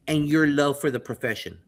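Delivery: ordinary delivery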